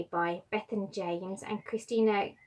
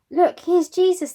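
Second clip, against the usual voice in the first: higher, louder, eager call to the crowd; excited call; 9 semitones higher, 11 dB louder than usual. Acted. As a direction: point him out with delight. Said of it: "they said".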